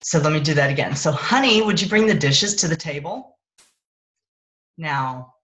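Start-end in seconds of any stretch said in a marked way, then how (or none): 1.1-3.4 slightly edgy, louder request; a little annoyed. Shown as a demonstration. Spouse asking for the dishes with a hint of annoyance. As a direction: let a little annoyance creep into the ask. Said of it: "sound like maybe a little annoyance".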